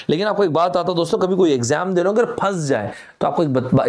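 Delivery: ordinary talk, nothing marked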